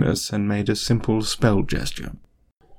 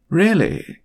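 amused disbelief; 9 semitones higher than usual. A narrator's reading. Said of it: "hiding a smile".

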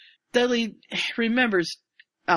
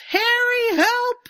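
very high, loud cry for help; Dudley panicking, yelling for Harry; desperate yell; 5 dB louder, slower than usual. Retold or imitated. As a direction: scream for Harry to save him. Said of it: "and he yells".

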